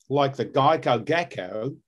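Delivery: slow Australian accent, like the Geico gecko; light Australian gecko voice. Shown as a demonstration. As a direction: drawl it as an Australian. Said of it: "did it a little bit more along this line".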